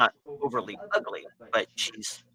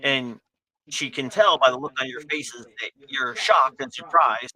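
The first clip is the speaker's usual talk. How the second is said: usual speaking voice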